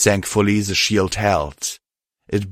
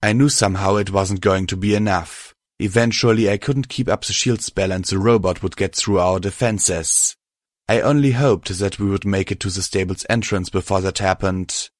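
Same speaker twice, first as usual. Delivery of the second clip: ordinary delivery, nothing marked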